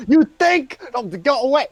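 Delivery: high, loud cheeky hand voice; mage hand shouting. Acted. Floating Mage Hand indignantly yelling to go away. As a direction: shoo them off, indignant. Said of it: "Mage Hand just kind of pops out".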